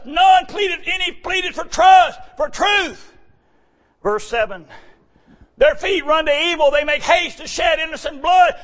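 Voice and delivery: high, loud fired-up sermon cadence; preaching at full force. Acted. Preacher calling to the congregation, loud and fervent.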